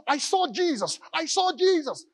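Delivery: put-on high, eager child's voice, pushing the point; a small girl who won't back down; little girl insisting.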